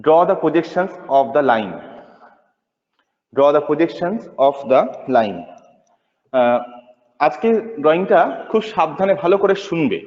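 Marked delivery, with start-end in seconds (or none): 0.0-5.4 slow, word-by-word dictating teacher; slow dictation. Acted. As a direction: spell it out slowly for note-taking. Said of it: "আমি dictate করছি".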